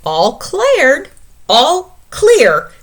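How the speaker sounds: high, loud announcement; declared. Acted. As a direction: declare it like a little soldier. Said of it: "declared Mouse".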